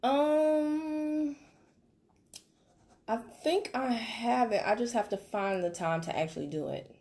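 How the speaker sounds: slow, hesitant put-off; customer Lisa. Pretend. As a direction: stall like a busy customer. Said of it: "If I'm Lisa".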